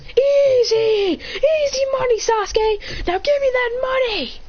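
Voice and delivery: high-pitched gloating shout; triumphant crowing. Acted. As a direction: crow over a won bet, pitch way up. Winner crows over his bet.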